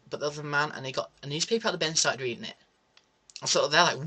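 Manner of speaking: usual speaking voice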